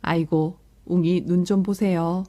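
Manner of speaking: ordinary delivery, nothing marked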